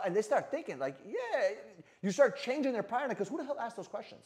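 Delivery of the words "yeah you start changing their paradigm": ordinary talk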